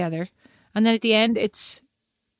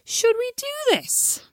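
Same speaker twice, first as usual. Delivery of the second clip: "Should we do this?" pitched way up, drawn out; exaggerated question tune; 10 semitones higher, slower than usual. Shown as a demonstration. Co-host testing how to sound like she's asking, exaggerated question tune. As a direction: overplay "Should we do this?" as a high, slow question. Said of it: "because we have very emphatic pronunciation".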